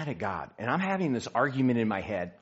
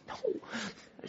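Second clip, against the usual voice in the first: high, whispered mock dismay; 14 semitones higher, 8 dB quieter than usual. Husband reacting to wife's unwanted okay, whispered mock dismay. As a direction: whisper it as if your plan just backfired.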